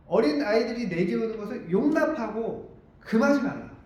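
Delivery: normal talking voice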